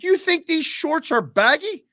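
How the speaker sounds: high, slow mock-offended question; sarcastic retort. Traveller mocking the searcher, high and sarcastic. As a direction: lift it high and let the sarcasm drag. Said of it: "I said".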